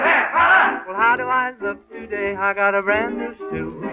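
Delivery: normal talking voice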